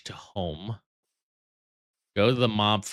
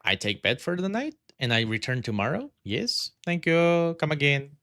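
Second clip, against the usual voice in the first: lightly voiced shopkeeper, higher on thanks; chirpy clerk farewell, lightly done; shopkeeper quote; 6 semitones higher than usual. Gamer quoting the shopkeeper line, light mock accent. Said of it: "that line from the Samsons".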